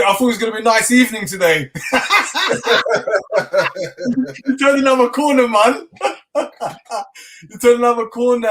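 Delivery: laughing and high as the story turns. Real, not acted. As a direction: talk through rising laughter. Laughing through telling the story.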